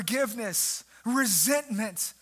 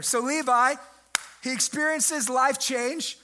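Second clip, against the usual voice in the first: usual speaking voice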